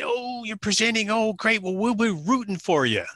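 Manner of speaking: high-pitched, gushing imitation of Midwesterners; mock Midwest accent. Midwest couple cheering on the presenter, high mock Midwest accent. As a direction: coo it high in a badly faked Midwest accent. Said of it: "I can't do the accent".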